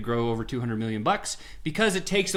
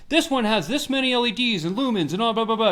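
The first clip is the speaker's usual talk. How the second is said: higher, louder rattling sales pitch; salesman going ham; 8 semitones higher, 5 dB louder than usual. Retold or imitated. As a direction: gush the specs like a hyped clerk. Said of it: "the sales associate goes ham".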